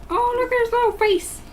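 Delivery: high cooing pet voice; cooing at cat. Real, not acted. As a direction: coo over his little face.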